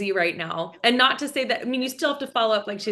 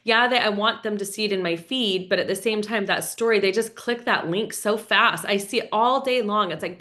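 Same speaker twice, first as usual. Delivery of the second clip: usual speaking voice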